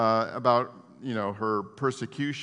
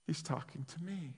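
hushed, almost breathless realization; awed hush; 3 semitones higher, 13 dB quieter than usual. Acted. Ruth realizing the verse speaks to her, hushed awe. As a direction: let it drop to a near whisper. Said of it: "she says".

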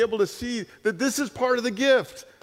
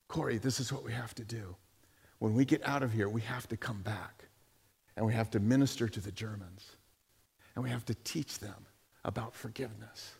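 soft, low voiced dying sister; a quiet, earnest last wish; hushed plea; 10 semitones lower, 11 dB quieter than usual. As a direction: say it gently, barely above a breath. Dying sister's charge in camp.

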